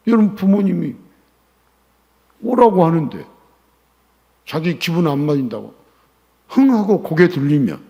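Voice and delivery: loud, high, driving sermon; fiery preaching. Acted. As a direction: hammer the point to the crowd. Preaching at full force. (열정적으로 외치는 설교)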